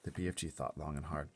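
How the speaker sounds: plain reading voice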